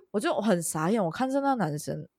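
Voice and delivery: normal talking voice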